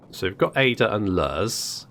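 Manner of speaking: ordinary talk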